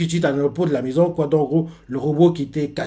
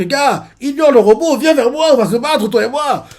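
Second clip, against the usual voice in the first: high, loud call to fight; a hammed-up challenge to the monster; villain calling the monster to fight him; taunting challenge; 10 semitones higher, 7 dB louder than usual.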